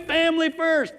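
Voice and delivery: "Family first" high and bright, still cheering; proud cheer. Retold or imitated. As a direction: shout "Family first" like a chant. Inner cheer, repeated.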